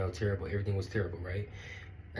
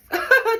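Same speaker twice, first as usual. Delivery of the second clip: shrieking laugh, much louder; high burst of laughter; 11 dB louder than usual. Real, not acted. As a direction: let out a squealing laugh. Laughing at her own story.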